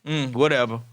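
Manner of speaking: dismissive, mumbled "whatever"; a touch of pouting indifference; sulky attitude. Retold or imitated. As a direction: brush him off with a sulk. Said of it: "have an attitude".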